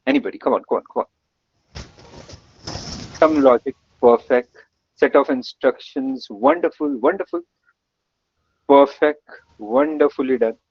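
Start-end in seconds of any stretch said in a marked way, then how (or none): none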